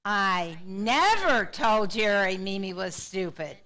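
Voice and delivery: slow sentence, hit never hard; stress on never. Shown as a demonstration. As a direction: lift never well above the other words. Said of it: "putting the emphasis on a different word".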